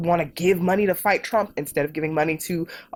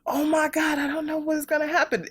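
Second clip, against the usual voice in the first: high, hammed-up fretting; a squeaky mock wail of worry; mock panic; 10 semitones higher than usual. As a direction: mimic someone panicking about the future. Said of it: "folks who are like".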